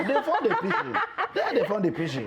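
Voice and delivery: words squeezed out through real laughter, starting high; laughing. Real, not acted. Teasing the interviewer about her pidgin, laughing through it. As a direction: laugh while you tease her.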